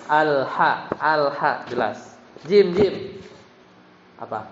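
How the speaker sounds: usual speaking voice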